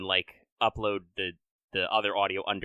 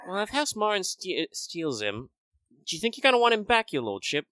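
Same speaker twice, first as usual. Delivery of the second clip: Moira calling out; 9 semitones higher than usual. Retold or imitated. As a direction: call out like a noble lady. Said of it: "Moira has to call out".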